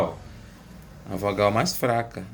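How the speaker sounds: ordinary talk, nothing marked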